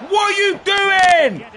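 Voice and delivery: slow, loud, high yell at the striker; drawn-out real outrage; furious shout. Real, not acted. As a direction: blast him for the miss. Striker misses a chance.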